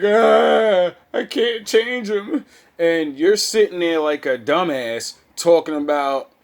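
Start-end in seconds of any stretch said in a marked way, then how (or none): 0.0-2.4 high, loud mock whimper of a heartbroken woman; whiny crying woman. Mocking a woman crying over her man. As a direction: imitate her whining, pitched up.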